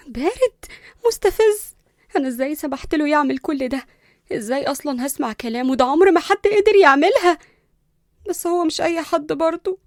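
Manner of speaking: high, exasperated venting; indignant. Acted. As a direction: fume at yourself, rising to a loud finish. Indignant venting alone after being ordered.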